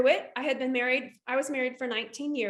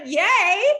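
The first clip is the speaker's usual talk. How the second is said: mock cheer; 6 semitones higher, 9 dB louder than usual. Reacting to the discount.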